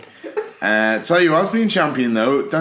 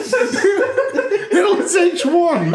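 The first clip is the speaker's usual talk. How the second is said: very high, slow squeal through laughter; helpless laughter; 12 semitones higher, much slower than usual. Real, not acted. Cracking up at his own speedball comparison, helpless laughter. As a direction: squeeze the words out while cracking up.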